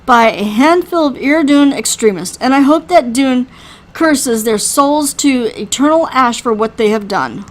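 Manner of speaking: loud, forceful burst of rage; enraged. Acted. Harriman denying any part in the horrible act, enraged. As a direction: deny it hotly, then curse the culprits. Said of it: "The rage in his voice surprises".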